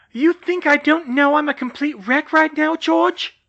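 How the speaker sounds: high, loud shout. Acted. Hermione shouting at George about being a wreck. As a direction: yell it at him, losing control. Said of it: "she shouted".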